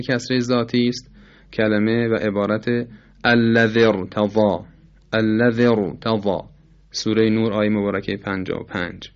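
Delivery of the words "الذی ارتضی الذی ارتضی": الذی ارتضی recited with a thick r; heavy ra. Shown as a demonstration. Teacher repeating the example, heavy r in الذی ارتضی. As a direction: join الذی to ارتضی on a full r.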